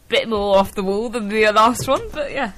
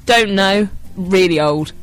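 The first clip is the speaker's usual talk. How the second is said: usual speaking voice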